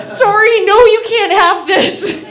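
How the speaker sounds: loud, high, strained apology; hammed-up apologetic yelp; apologetic people pleaser. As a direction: wail a polite refusal while holding on. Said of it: "I'm, like, such a people pleaser".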